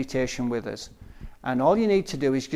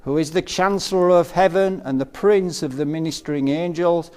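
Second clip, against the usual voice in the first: solemn invocation; 2 semitones higher, 9 dB louder than usual. Real, not acted. Meditation leader calling archangels to help the group, solemn invocation.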